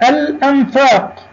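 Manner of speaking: الأنفاق with a clearly sounded l; high teacher demo, separate the l in الأنفاق; moon lam. Shown as a demonstration. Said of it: "مثلها لام قمرية". (نطق «الأنفاق» بلام ساكنة واضحة)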